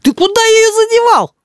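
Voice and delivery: piercingly high accusation; angry child's cry. A narrator's reading. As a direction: demand an answer like a stamping little boy. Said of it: "крикнул Рис и затопал ногами".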